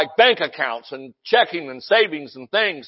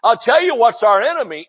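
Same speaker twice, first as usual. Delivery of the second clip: ordinary talk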